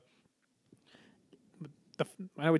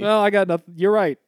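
loud, raised sarcastic giving in; mock concession; 5 semitones higher, 7 dB louder than usual. Man mocking conceding to his coworker, loud and sarcastic. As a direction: concede with a wink.

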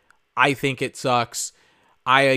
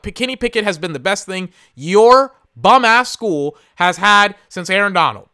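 louder, higher comeback aimed at Pitt fans; heated retort; 5 semitones higher, 6 dB louder than usual. Retold or imitated. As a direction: snap back at the fans with loud indignation.

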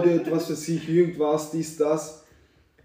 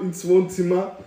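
normal talking voice

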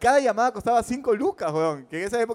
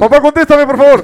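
loud, high, desperate begging; pleading child; 3 semitones higher, 14 dB louder than usual. Retold or imitated. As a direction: beg your dad to pick up. Kid begging on collect call.

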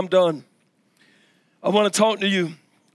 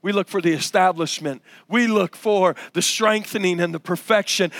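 normal talking voice

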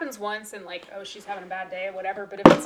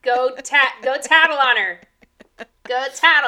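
loud, laughing command; playful yelling; 4 semitones higher, 12 dB louder than usual. Pretend. Friend egging the other on to report, playful yell. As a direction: holler it as a joking order.

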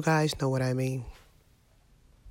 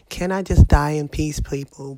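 much louder, pleading outburst; exasperated outburst; 9 dB louder than usual. Real, not acted. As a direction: cry out to everyone, fed up.

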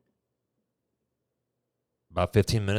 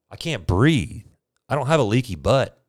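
exasperated insistence; 6 semitones higher, 6 dB louder than usual. Retold or imitated. Patient insisting again.

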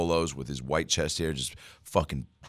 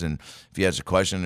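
ordinary talk

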